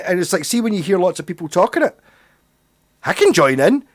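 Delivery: normal talking voice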